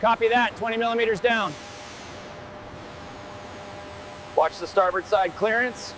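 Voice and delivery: crew chatter over headset radio; helmet radio. Real, not acted. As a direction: sound like a radio transmission.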